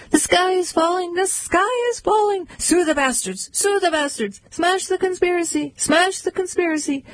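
high, frantic little chick crying alarm; high-pitched alarmed chicken voice; panicky Chicken Little. Acted. Three birds run chanting.

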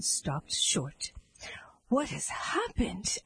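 slow, breathless whisper over the bodies; hushed whisper. A narrator's reading. Prince at the bodies, asking in a whisper. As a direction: whisper it, shocked into silence.

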